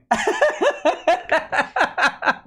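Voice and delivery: high cackling laugh; giggle. Real, not acted. Streamer laughing at a debtor's missed payments, high giggle. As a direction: let the laugh bubble up high.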